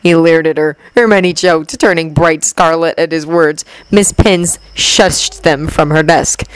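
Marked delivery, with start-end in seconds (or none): none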